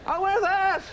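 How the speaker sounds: high, incredulous comic questioning; hammed-up observational comedian voice; Jerry Seinfeld. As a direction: pitch it way up, querulous sitcom banter. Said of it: "Such a Seinfeldy bit".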